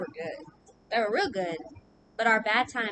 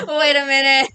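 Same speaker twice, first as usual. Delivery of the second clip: loud, higher, through laughter; laughing disbelief; 4 semitones higher, 14 dB louder than usual. Retold or imitated. Retelling a shocking phone call, laughing in disbelief. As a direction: burst out laughing while calling a halt.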